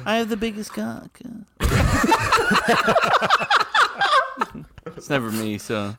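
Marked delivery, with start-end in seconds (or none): 0.1-1.2 high put-on girly voice; girl voice. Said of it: "when you did your girl voice". 1.2-4.6 long high cackling laugh; loud high laugh. Real, not acted. Loud laugh after joke.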